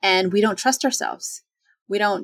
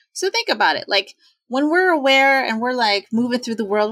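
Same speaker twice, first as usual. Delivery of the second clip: ordinary delivery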